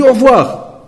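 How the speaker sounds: voix du voisin, bien plus aiguë et sèche; congédier l'intrus d'un ton méprisant. Retold or imitated. Neighbour brushes him off again.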